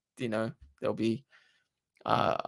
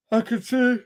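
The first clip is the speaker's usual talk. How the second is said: words stretched through a yawn; 9 semitones higher, 4 dB louder than usual. Real, not acted. Streamer yawning to chat while answering. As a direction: talk mid-yawn, mouth wide. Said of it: "Sorry, I'm yawning".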